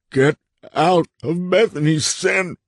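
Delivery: slow, high, anguished cry; half shout, half sob. A narrator's reading. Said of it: "said in a half shout, half sob".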